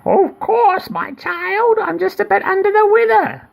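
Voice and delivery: very high mock granny voice; wolf sweetly faking; wolf as old lady. Wolf reassuring girl in very high mock granny voice. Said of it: "said the wolf".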